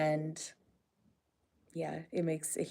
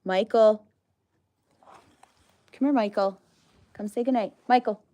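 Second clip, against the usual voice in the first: higher, louder coaxing call; calling an animal; 5 semitones higher, 7 dB louder than usual. Real, not acted. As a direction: call out to coax him over. Calling the alpaca over.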